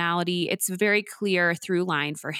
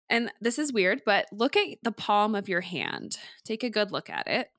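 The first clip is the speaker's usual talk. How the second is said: guided meditation; 3 dB quieter than usual. Acted. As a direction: gently talk them through each breath. Leads a palm-tracing breathing exercise.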